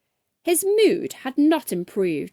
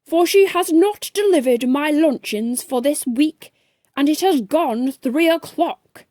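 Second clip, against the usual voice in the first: louder, higher indignant rant; pompous man scolding the trainee; angry posh complaint; 4 semitones higher, 6 dB louder than usual. A narrator's reading. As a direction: huff like an outraged gentleman.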